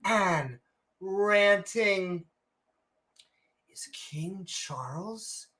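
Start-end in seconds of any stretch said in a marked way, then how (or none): 3.7-5.5 dramatic whisper. Acted. Dramatic show question.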